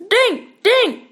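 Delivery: put-on high small child's cry of drink; little girl crying out. A narrator's reading. Toddler Emma cries out for a drink. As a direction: echo the word like a toddler begging. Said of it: "cried Emma".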